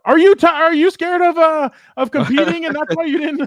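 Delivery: high, loud mock shout; imagined shout. Cohost imagining Gilbert's outburst, high mock shout. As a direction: belt it out as if calling out a rival. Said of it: "I thought Gilbert was gonna shout out".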